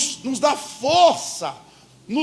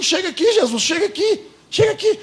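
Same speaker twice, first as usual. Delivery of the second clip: pleading high call; 10 semitones higher than usual. Shown as a demonstration. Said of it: "Fala mais baixinho".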